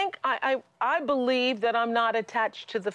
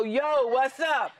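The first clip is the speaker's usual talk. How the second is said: mock street greeting; 7 semitones higher, much slower than usual. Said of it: "If Eckhart walks in and go".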